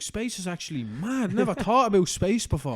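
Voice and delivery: high, loud gormless wonder; dumb fanboy voice. Retold or imitated. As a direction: marvel like a clueless stoner fan. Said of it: "And it's just like".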